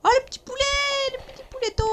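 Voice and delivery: very high cooing voice. Real, not acted. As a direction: coo at it like a pet. Cooing at a chicken.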